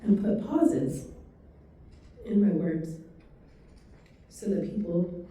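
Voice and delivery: slow monotone with long pauses between phrases; measured, robotic pacing for a listener who processes slowly; slow, paused demo. Shown as a demonstration. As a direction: talk slowly, breaking the sentence into small chunks. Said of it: "when I talk like that".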